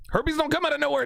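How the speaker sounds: "come" hit high and hard; stress on come. Real, not acted. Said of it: "I'm here to tell you".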